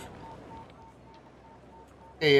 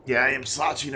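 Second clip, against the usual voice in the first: ordinary delivery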